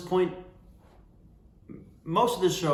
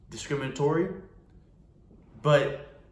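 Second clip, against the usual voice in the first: ordinary talk, nothing marked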